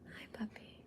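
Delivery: soft unvoiced whisper to the dog. Real, not acted. Greeting her puppy in a soft whisper. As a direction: breathe it to the puppy.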